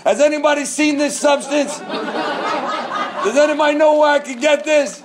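hammed-up eager seeker, giggling; mock-excited buyer laughing as he asks; eager asking around. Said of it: "and I was, like".

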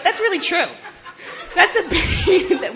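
high, laughing and slowing down. Real, not acted. Telling the group about being her mother's bane, laughing. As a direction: laugh through the words, stretched out.

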